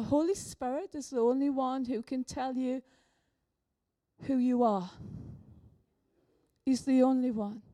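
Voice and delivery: ordinary talk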